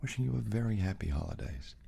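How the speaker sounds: ordinary talk, nothing marked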